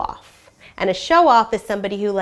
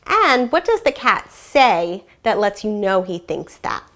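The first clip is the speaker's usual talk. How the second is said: lively, expressive storybook reading with animal voices; story read aloud to children; 3 semitones higher, 4 dB louder than usual. A narrator's reading. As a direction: read it like a classroom read-aloud, bringing each animal to life. Teacher reads picture book aloud.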